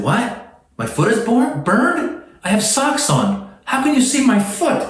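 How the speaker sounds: can't believe what he's reading; baffled disbelief. Retold or imitated. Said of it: "I was like".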